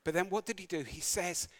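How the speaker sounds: normal talking voice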